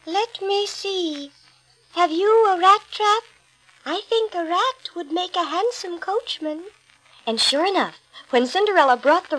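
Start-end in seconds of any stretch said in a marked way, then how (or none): 0.1-6.7 high, slow musing fairy voice; fairy godmother. A narrator's reading. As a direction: ponder it slowly, kindly. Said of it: "Then the fairy godmother said".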